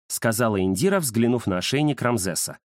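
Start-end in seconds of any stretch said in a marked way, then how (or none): none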